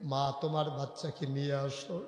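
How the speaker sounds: low, quiet, even delivery; soft intoned request. Acted. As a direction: say it softly in a storyteller's lilt. Soft first take of Prophet's words.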